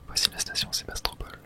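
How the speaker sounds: normal talking voice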